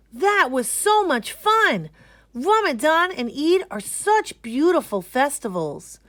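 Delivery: high, loud delighted gush; thrilled kid. Acted. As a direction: gush about the fun. Kids thrilled after festivities.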